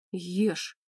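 terse, mildly gruff order; gloomy. A narrator's reading. Ренат answers her praise of his food, mildly gloomy. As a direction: give the order with a touch of gloom. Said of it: "хмуро ответил он".

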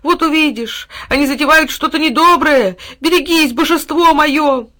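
high, loud desperate warning, slowing at the end; urgent warning. A narrator's reading. Dog warning his master of danger. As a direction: plead and warn him. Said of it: "продолжал взывать к своему хозяину пёс".

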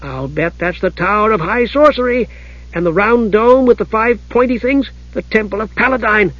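high, eager kender chatter; excited. A narrator's reading. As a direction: rattle off discoveries in a perky high voice. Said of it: "said Tass excitedly".

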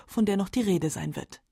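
normal talking voice

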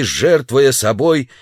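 ordinary delivery, nothing marked

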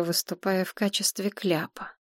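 usual speaking voice

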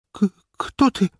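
put-on high, stammering, scared girl's voice; frightened stammer. Scared girl stammers question. (испуганно, заикаясь, высоким голосом)

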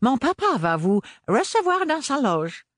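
put-on high, polite little boy voice; a sweet child with a gun. A narrator's reading. Child with pistol speaks.

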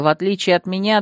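ordinary delivery

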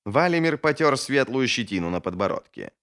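ordinary delivery, nothing marked

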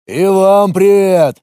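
slow, loud bass greeting; booming big-man hello, drawn out; dopey deep voice. A narrator's reading. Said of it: "басом произнёс русич". (глуповатый бас)

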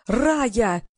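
mildly strained, stretched call; narrated holler, held back; straining call. A narrator's reading. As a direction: call out with a touch of desperation. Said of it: "надрывался Вадим".

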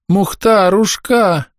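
high, plaintive cry for a pet; distressed calling. A narrator's reading. Old woman calls her injured dog, distressed cry. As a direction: call out high, drawing out the pet name.

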